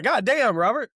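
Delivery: exasperated. Retold or imitated. Retold frustrated outburst.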